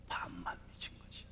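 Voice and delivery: hushed whispered aside; whispering. Real, not acted. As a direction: whisper it close. Confiding punchline. (속삭이듯)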